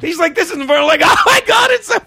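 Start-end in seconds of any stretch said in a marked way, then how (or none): 0.3-0.8 indignant. Retold or imitated. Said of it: "a pissed off Kevin Brennan". 1.0-2.0 high, loud, giddy squeal; laughing excitedly. Retold or imitated. Friends cracking up at him.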